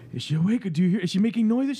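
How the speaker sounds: worried parent. Acted. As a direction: ask like a nervous new parent. Acted worried parent asking partner.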